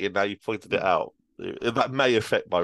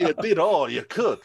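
cowboy Superman; 7 semitones higher, 3 dB louder than usual. Acted. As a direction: lean into the cowboy hero. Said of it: "gonna lean into it".

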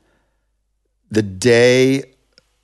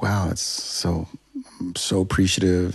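mock humble gratitude; 5 dB quieter than usual. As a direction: play the humble nominee, gushing softly. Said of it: "you're trying to be nice".

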